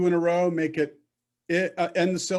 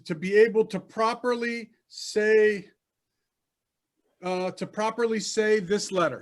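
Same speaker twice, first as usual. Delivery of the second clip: usual speaking voice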